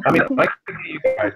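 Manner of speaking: glitchy robotic connection audio; robotic, broken-up sound over a bad line. Real, not acted. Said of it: "I'm probably gonna sound like a robot".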